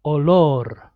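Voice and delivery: "olor" ending on a trilled R; Spanish "olor" with trill at the end; multiple R. Shown as a demonstration. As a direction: roll the final R of "olor". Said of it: "Again, multiple".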